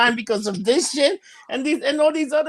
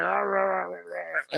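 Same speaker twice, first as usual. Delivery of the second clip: lightly voiced mock rant, trailing off softer; mock angry ranting; 5 semitones lower, 9 dB quieter than usual. Mocking angry Facebook commenters, a lightly voiced dismissive babble. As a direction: brush off angry people with a lazy rah rah. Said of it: "some people are like".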